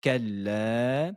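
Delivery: كلا slowly, final alif drawn two beats; drawn-out vowel. Shown as a demonstration. Teacher showing students the two-beat vowel in كلا. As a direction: stretch the end of كلا. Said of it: "donc prolongé de deux temps".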